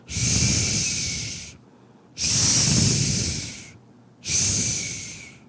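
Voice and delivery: whispered long shh; breathy calming shh; soft shushing. Retold or imitated. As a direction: hush the wind like a parent. God hushing the storm.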